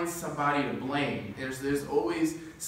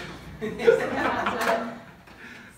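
breathy high giggle; helpless high laugh; real laugh; 10 semitones higher than usual. Real, not acted. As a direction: burst into a giggle at yourself. Laughs at own joke.

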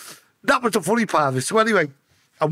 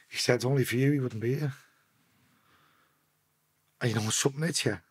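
low, hushed, halting with emotion; really emotional; 7 semitones lower, 8 dB quieter than usual. Real, not acted. Telling how he saved his mate's life, choked-up and quiet. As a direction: struggle to hold it together between long pauses. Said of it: "Why does it get you so emotional".

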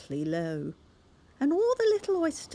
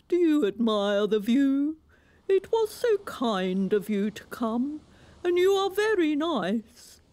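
grand walrus; 8 semitones higher, 5 dB louder than usual. A narrator's reading. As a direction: announce it like a pompous walrus. Walrus speaks.

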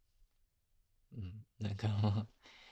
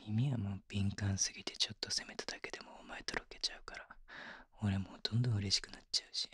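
soft breathy whisper in her ear; intimate whisper; 4 dB quieter than usual. Acted. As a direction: whisper it close, like only she should hear.

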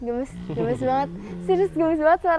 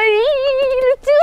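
high, loud squeal of delight; cute-overload squeal; 10 semitones higher, 6 dB louder, slower than usual. Real, not acted. Host gushing over cute tigers, excited squeal. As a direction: squeal over something adorable, breaking into laughter.

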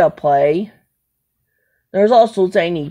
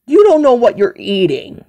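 ordinary talk

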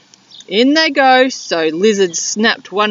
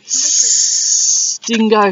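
hissing lizard; much slower than usual. Acted. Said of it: "he hissed".

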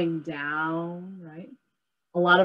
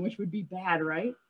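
ordinary talk

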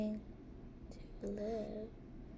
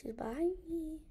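high unvoiced whisper; frightened whisper; 5 semitones higher than usual. Real, not acted. Player warning that she is behind, frightened whisper. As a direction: whisper it so she won't hear.

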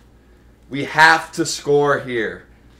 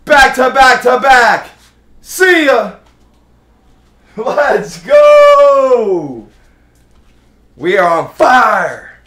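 high, loud victory yell; hype shouting; 8 semitones higher, 9 dB louder, slower than usual. Real, not acted. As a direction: celebrate the homers at full volume. Back-to-back home runs.

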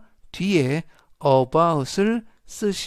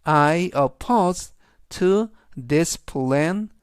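slow model read; much slower than usual. Shown as a demonstration. Teacher reads the example to students, slow and deliberate. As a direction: spell the example out slowly for learners. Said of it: "즉, 전체적인 의미는".

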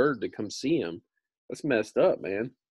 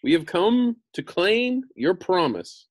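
put-on lifted high, girlish Dorothy; Dorothy's voice; 9 semitones higher, 5 dB louder than usual. A narrator's reading. Reader voicing Dorothy asking the wizard, pitched high. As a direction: pitch up high for the young girl.

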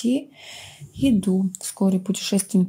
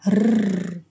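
angry tiger growl. A narrator's reading. Tiger opens its jaws at the cub, angry growl. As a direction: growl like a tiger about to pounce. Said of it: "злобно зарычал".

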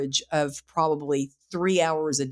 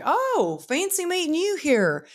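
high, sing-song mock surprise; coy, high-pitched fancy meeting you; playful coy greeting; 12 semitones higher than usual. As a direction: tease him with a bright, much higher lilt. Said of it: "I was like".

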